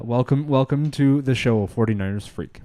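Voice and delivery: usual speaking voice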